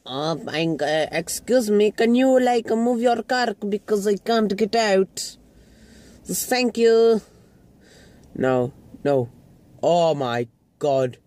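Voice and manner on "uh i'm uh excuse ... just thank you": higher-pitched mock polite request; imitating mum. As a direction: put on mum asking a favour, pitched up. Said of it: "and Mum's like".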